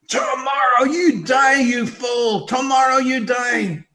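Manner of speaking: loud, slow, high rebuke; stern warning shout. Pretend. Voice warning the giggling prisoner, slow loud shout. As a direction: shout it at the fool. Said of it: "voiced warning to the fool".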